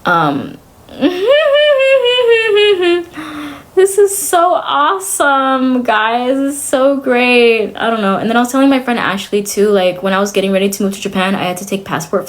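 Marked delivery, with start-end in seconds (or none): 1.0-3.7 long, high-pitched fake giggle; forced giggle. Giggling at her own grim story, high and forced. 3.8-7.8 drawn-out, higher mock cheer; sarcastic delight. Telling viewers her bad situation is great, sarcastically delighted.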